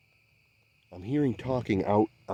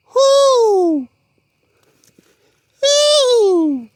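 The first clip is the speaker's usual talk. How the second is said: high, loud owl hoot call; 13 dB louder than usual. Shown as a demonstration. Answering an owl in the woods, high loud hoot. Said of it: "that was an owl".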